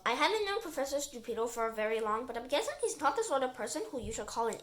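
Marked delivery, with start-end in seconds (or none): none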